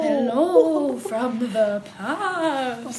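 very slow, drawn-out ghostly moan; unearthly voice. Acted. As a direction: stretch every word like a spirit. Ghostly greeting bit.

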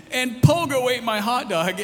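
put-on high, loud kid piping up out of nowhere; little kid voice. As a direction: mimic the toddler blurting it out again. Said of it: "Miles would chime in".

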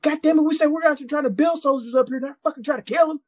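high-pitched mock sergeant tirade; high, indignant sergeant rant; ranting first sergeant. Retold sergeant's angry tirade.

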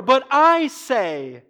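high, loud, drawn-out I; ringing stress on the word I; emphatic I. Retold or imitated. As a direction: proclaim it slowly, hitting I. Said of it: "The I is emphatic".

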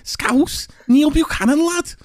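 high, hammed-up Scouse accent; mock Scouse. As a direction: put on a Scouse voice, lad.

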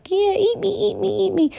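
high teasing voice of the food; tempting food voice. Pretend. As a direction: tempt her like the cake calling out. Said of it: "and it's like".

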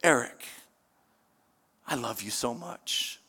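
soft, low, tender pleading; tender father. Acted. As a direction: speak like a loving father to a lost son. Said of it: "love of a father that says".

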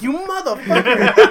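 drawn-out, high, loud mock curse; laughing outrage, stretch the words out. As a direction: curse them slowly through a laugh. Acted reaction to being tricked by vet.

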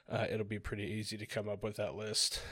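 normal talking voice

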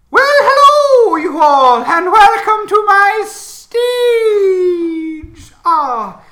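booming, slow showman voice from nowhere; loud, drawn-out ringmaster echoing out of the ether; unearthly voice. Acted. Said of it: "you hear an echoing voice, uh, from out of the ether".

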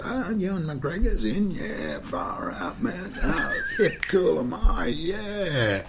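high, drawn-out gushing with a laugh; mock showing-off. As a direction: send up a starstruck boaster. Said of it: "I didn't want to go".

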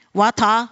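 WATER ending in an open "ah", no R; non-rhotic water. Real, not acted. Bible reader slips into another pronunciation, "water" as "wah-tah". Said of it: "I'm tempted to say water".

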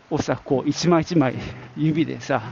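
normal talking voice

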